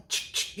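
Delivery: faint whispered ch-ch stamp; stamp noise. Retold or imitated. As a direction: click like a stamper. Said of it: "you stamp it".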